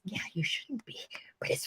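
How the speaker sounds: conspiratorial whisper; whispered aside. Real, not acted. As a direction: breathe it as a secret aside. Aside about interview.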